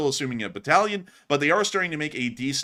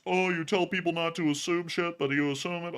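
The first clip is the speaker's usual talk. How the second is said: higher-pitched mock complaint in a sustained whine; parroting critics, pitched up; mocking typical critics; 6 semitones higher than usual. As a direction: imitate naysayers in a put-on, higher voice. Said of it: "we got the typical statements".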